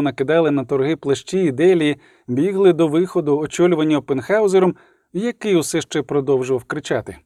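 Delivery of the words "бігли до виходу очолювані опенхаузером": ordinary delivery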